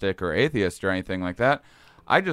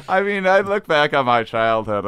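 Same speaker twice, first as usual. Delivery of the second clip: ordinary talk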